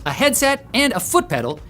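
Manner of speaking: normal talking voice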